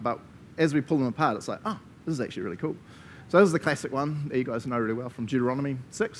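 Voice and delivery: ordinary talk, nothing marked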